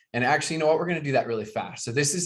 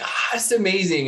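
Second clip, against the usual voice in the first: excited gush; 7 semitones higher, 4 dB louder than usual. Retold or imitated. Excited convention-goer voiced.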